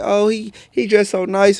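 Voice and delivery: lightly voiced admiring mother; imitating mom. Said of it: "My mom be, be like".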